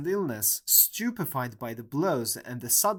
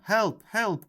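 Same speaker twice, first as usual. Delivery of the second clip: high, loud desperate call; cry for help; 8 semitones higher, 6 dB louder than usual. A narrator's reading. Oliver calling to the street for help, high and loud. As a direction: shout for help as he struggles. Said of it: "cried Oliver".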